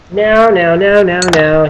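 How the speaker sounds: normal talking voice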